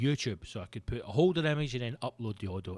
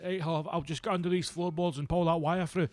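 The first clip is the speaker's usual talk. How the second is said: pitched-up mock workman quote; tradesman; 5 semitones higher than usual. Workie handed a latte turns it down, as a mock tradesman. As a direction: play a workie shrugging off the fancy latte. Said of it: "who went".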